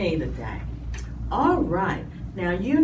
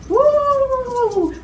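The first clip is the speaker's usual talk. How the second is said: long high whoop; excited whoop; 12 semitones higher, 4 dB louder than usual. Pretend. Host starting a pretend run with the children, excited whoop. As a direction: whoop as you take off running.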